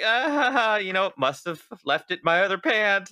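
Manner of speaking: high, airy fake laugh, then the deflecting joke; joking. Joking off the MAGA hat question, high and breezy. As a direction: laugh it off with a high mock brush-off.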